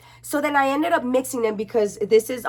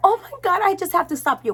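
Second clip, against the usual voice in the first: excited gasp; 8 semitones higher, faster than usual. Retold or imitated. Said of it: "She's like".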